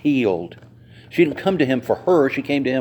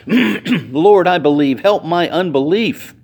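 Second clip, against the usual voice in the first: a cry for help, louder and higher, with tears; the boy's father cries out to Jesus, tearful and loud; 5 semitones higher, 5 dB louder, slower than usual. A narrator's reading. Father pleading through tears with Jesus, loud. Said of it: "cried out and said with tears".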